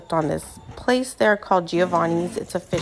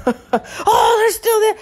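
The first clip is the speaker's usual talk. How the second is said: much higher put-on voice, laughing through it; laughing dad voice; 4 dB louder, much slower than usual. Dad spotting more ember, high and laughing.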